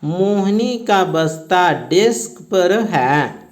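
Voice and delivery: slow, spelled-out dictation for pupils. Shown as a demonstration. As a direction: read the sentence slowly so children can write it. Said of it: "तो लिखा रहेगा". (धीमी गति से लिखवाना)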